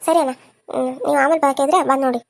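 ordinary talk

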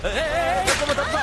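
high, repeated warning cries; urgent warning. Acted. Passenger warning someone in the road, urgent cries. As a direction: call out to clear the way.

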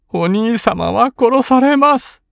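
high, slow, tearful cry; breaking into tears. A narrator's reading. Kin tells her brother he will be killed, tearful. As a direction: let the tears come as she speaks. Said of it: "と言って金は泣き出した".

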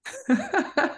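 high-pitched burst of laughter. Real, not acted. Laughs after teasing line.

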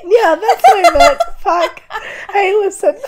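high, loud laughing speech; laughing hard. Real, not acted. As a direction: burst out laughing mid-sentence. Laughing at her own slip.

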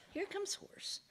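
high, soft, hopeful repeat; hushed excitement. Acted. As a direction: squeak it quietly with rising hope. Man repeats it, hope rising.

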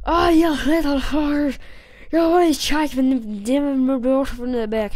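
loud, slurring angry lumberjack; drunk Irish lumberjack. Acted. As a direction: bellow like a drunken Irish woodcutter. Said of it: "No, it's more like".

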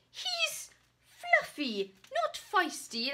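loud, high, slow protest; indignant. Acted. Knight loudly protesting that the ferret is fluffy. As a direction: squeal the complaint in disbelief.

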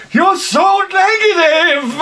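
high, slow whiny wife voice; shrill mock of the wife complaining. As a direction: whine it like a nagging wife.

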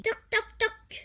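voiced knock, bright and loud; knocking sound. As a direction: knock on the door with your voice. Knocking at the door.

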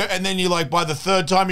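ordinary talk

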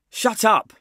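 loud high angry snap; snapping. Acted. Maxie silencing the two boys, loud angry snap. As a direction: cut them off sharply. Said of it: "Maxie snapped at the two of them".

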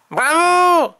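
high, loud, drawn-out cheer; cheering Tom. A narrator's reading. Tom applauds the vanishing rabbit, high loud cheer. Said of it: "Dit Tom".

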